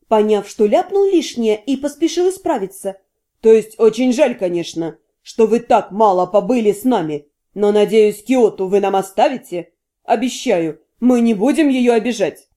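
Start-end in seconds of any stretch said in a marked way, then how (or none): 3.4-12.5 low, hurried backpedaling voice; flustered male voice. A narrator's reading. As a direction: lower voice, scrambling to fix it. Henrich hastily corrects himself.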